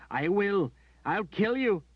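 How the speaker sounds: high, loud, tearful threat; high anguished shout; desperate shout. A narrator's reading. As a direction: shout the threat through tears. Berdine threatening in despair.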